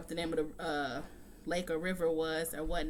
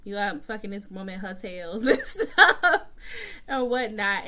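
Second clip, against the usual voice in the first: laughing while quoting; 5 dB louder than usual. Retold or imitated. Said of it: "and Letty is like".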